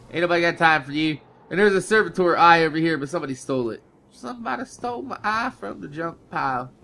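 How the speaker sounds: ordinary talk, nothing marked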